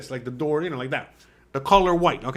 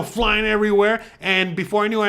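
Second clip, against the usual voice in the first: plain reading voice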